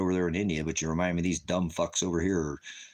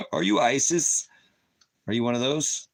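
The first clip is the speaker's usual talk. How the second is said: mocking question; 5 semitones higher, 4 dB louder than usual. Real, not acted. Mocking a viewer.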